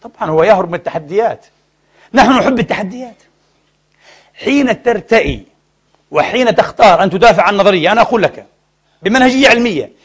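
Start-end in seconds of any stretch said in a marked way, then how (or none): none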